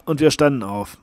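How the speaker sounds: ordinary delivery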